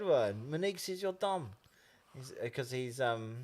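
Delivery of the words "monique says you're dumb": put-on catchphrase in high-pitched rural Māori accent. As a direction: mimic the ad's rural Māori speaker. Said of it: "the way I was talking right then".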